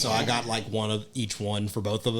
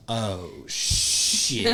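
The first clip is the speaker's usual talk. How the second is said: OH, SHIT stretched out slowly; drawn-out dismay. Retold or imitated. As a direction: let "Oh, shit" dawn gradually. Retold reaction on spotting child.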